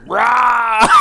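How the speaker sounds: loud, high bellowed rah; mock roar. Imitating the ranter losing it, a loud mock roar. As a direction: roar like a wrestler ripping his shirt. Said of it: "you just hear".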